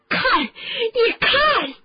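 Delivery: high, drawn-out bellow; furious roar. A narrator's reading. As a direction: roar it out loud and high, stretching each word. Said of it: "罗恩咆哮着".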